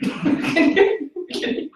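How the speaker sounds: short breathy laugh. Real, not acted. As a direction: burst of laughter. Laughing at creepy voice.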